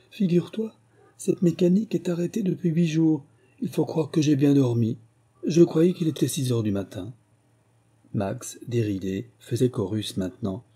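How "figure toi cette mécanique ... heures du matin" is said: high, merry explanation; a touch of mirth, pitched up without laughing; amused. A narrator's reading. Sister explaining her stopped clock to brother, amused. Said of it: "elle éclata de rire".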